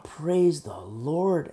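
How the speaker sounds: slow, stretched "praise the Lord"; drawn-out praise. Retold or imitated. Preacher answering a crying mom with a slow, drawn-out praise. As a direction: savour each word of the praise.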